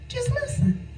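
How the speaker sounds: soft, high hushed advice; preacher urging quiet listening, hushed and high. Real, not acted. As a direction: breathe it out very softly.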